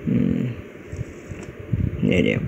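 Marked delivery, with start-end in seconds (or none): none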